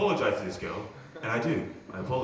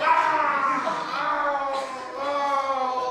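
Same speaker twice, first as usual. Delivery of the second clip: mock grunts; 12 semitones higher, 3 dB louder, much slower than usual. Imitating Igor's grunts.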